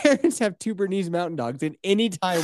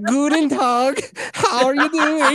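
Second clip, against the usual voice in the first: higher, louder teasing impression; mock Kelsey greeting; 8 semitones higher, 5 dB louder than usual. Host teasing cohost about her accent, high mock imitation. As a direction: pipe up in a mocking greeting. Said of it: "Kelsey's like".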